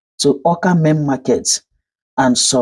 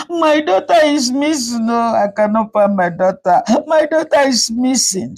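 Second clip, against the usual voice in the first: high, tearful cry of panic; 7 semitones higher than usual. A narrator's reading. Mother finding her daughter gone, crying out in panic. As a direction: sob it out, desperate.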